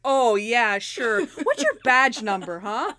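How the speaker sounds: loud mock-sarcastic comeback; hammed-up combative retort; scornful challenge. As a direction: demand it with acted disbelief. [name] challenges sergeant in acted quarrel.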